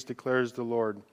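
ordinary talk

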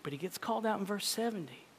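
ordinary talk, nothing marked

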